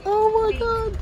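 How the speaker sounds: very high, stunned gasp of awe; amazed. Real, not acted. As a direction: squeal it in disbelief. Amazed at the pink mosque.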